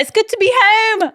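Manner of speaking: high-pitched mock pop star; mock homecoming announcement; imitated homecoming cry. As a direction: gush it in a high put-on voice. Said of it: "it was like".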